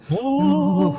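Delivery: high, loud ghostly moan; creepy ghost voice. Pretend.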